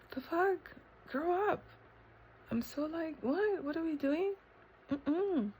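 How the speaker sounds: high-pitched playful disapproval; silly, incredulous high voice; mock disbelief. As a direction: question it high, then refuse with a hum.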